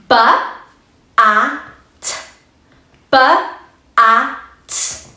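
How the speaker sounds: "Ba", "a", "t" as separate sounds; sounding out. Shown as a demonstration. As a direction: phonics sound-by-sound. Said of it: "Let's blend it".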